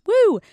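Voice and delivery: a loud high woo, half joking; high excited whoop. Real, not acted. Cheering herself on as a hot girl, loud and high. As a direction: whoop like you won something.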